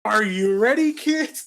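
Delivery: put-on high, loud rallying call to the kids; pirate captain call. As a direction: shout it out like a cartoon pirate captain. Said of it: "No, I'm not gonna start off like that".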